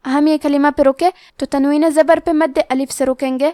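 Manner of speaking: usual speaking voice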